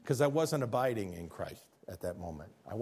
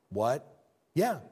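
normal talking voice

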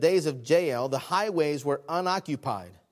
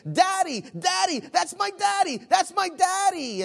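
put-on little girl screaming; 12 semitones higher than usual. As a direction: shriek it like a three-year-old spotting her father. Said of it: "still yelling".

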